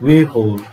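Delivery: "withhold" as "wi-hold", falling; British pronunciation. Shown as a demonstration. Teacher repeating the model for students, "withhold" as "wi-hold". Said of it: "withhold is British pronunciation".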